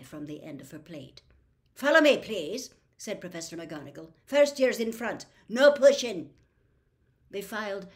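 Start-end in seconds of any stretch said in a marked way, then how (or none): 1.7-2.7 stern teacher call. A narrator's reading. Teacher ordering students into lines. 4.2-6.3 stern teacher command. A narrator's reading. Teacher ordering students into lines.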